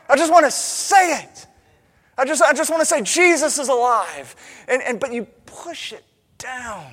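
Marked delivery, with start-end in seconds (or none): 0.1-4.3 loud, high, insistent outburst; pent-up fervor. Acted. Believer aching to proclaim Jesus alive, fervent outburst. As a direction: let the held-back declaration burst out. Voicing pent-up urge to declare faith.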